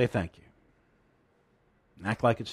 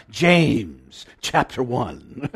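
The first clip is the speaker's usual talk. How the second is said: slow, louder mock-British Bible reader; drawn-out British announcement, breaking into a laugh; imitated British accent; 2 semitones lower, 4 dB louder, much slower than usual. Preacher echoing his Bible app reader, strong British accent. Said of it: "it just wants to come out".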